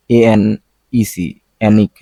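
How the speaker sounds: ordinary talk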